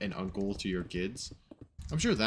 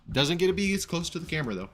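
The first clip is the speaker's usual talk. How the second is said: higher, louder, fast teasing; excited teasing; 9 semitones higher, 5 dB louder, faster than usual. Real, not acted. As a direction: tease them playfully, fast. Teasing chat after kiss.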